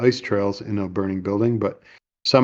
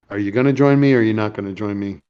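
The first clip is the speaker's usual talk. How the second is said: ordinary delivery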